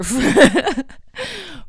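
loud laugh. Real, not acted. Podcaster laughing at her own excuse.

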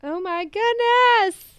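high, loud, drawn-out amazement; real delight. Real, not acted. Fan browsing returned dolls, overjoyed. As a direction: stretch it out, rising into a thrilled cry.

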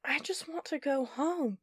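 strained, slow, through teeth; gritted teeth. Acted. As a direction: hold back the rage. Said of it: "her teeth gritted".

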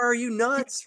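high-pitched mock-incredulous cry; mind shouting. The mind challenging the gut's plan, high mock shouting. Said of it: "the mind shouts".

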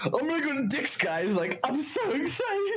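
high, giddy retelling of a friend; mock-excited friend; excited quote. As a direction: gush like a kid about to go. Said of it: "He's like".

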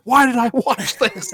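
high, slow, laughing disbelief; exasperated laugh. Retold or imitated. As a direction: groan it out half laughing. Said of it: "I'm like".

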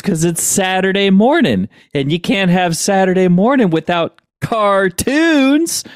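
hammed-up gleeful announcement building to the last word; excited character quote. Podcaster retells the movie's big cartoons punchline, hammed-up and excited. Acting out cartoon fan's final line.

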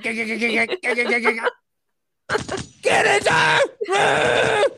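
high, strained hollering over gunfire; desperate, hammed-up rescuer's yell; frantic mock shouting. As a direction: scream it high, dragging out the words. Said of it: "Just like".

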